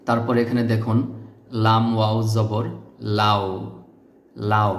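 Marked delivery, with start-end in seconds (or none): 3.2-4.7 লাও with a rounded o glide at the end; model pronunciation. Shown as a demonstration. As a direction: say লাও, gliding into o. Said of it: "এভাবে উচ্চারণ করতে হবে". (লাও স্পষ্ট উচ্চারণ)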